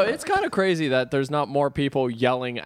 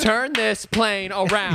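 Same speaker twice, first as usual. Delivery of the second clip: high, drawn-out indignant order; mock bossy command; 7 semitones higher, slower than usual. Passenger ordering hijacker to turn back, indignant. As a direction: stretch each word out, demanding.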